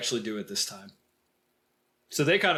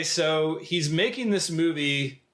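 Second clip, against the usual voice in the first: ordinary talk, nothing marked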